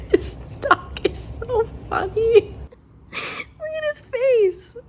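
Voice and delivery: high, squeaky pet-talk voice; cooing at the dog. Real, not acted. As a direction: coo over the dog's funny face. Talking about the dog she's sitting.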